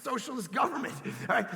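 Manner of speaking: ordinary talk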